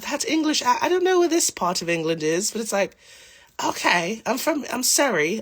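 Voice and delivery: high, lilting Surrey English; playful mock-Surrey English voice; sing-songy English accent. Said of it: "my favorite English accent 'cause it's so, like, sing-songy".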